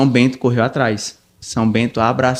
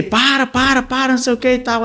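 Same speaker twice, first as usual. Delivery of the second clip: retold yell; 10 semitones higher than usual. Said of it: "aí eu gritei".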